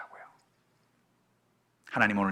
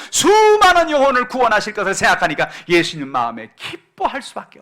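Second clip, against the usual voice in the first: high, loud, impassioned preacher's cry; fervent preaching; 14 dB louder than usual. Acted. Pastor preaching joy to the congregation, high and loud fervour. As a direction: pour it out over the congregation at full force.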